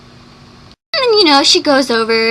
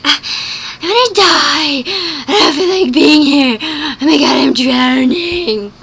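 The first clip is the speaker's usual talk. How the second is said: hammed-up despair, a bit louder; mock melodrama; 4 semitones higher, 3 dB louder than usual. Mocking Bella's dramatic lines, hammed-up despair. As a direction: overplay the damsel in distress.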